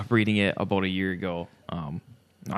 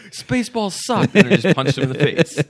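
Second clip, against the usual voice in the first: mock attacker voice; 7 semitones higher, 8 dB louder than usual. Podcast host jokingly voices the attacker, high mock taunt. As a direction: ham up the guy who punched Rick Moranis.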